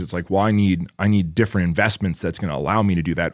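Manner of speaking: ordinary talk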